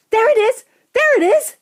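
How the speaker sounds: very high, loud repeated cry; excited shout. A narrator's reading. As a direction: yell it twice as an excited onlooker. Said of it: "someone else shouted".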